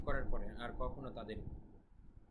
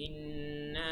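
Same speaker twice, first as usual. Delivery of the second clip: ইন্না held very long on a raised note; long elongation; 4 semitones higher, much slower than usual. Shown as a demonstration. Teacher shows students the three-alif stretch, drawn-out ইন্না. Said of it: "এখানে তিন আলিফ টান হবে".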